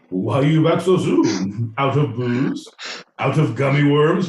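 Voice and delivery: playful, loud teasing questions; chummy delivery worker. Acted. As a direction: greet a regular customer with mock surprise. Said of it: "They're like".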